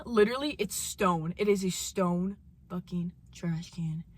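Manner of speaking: normal talking voice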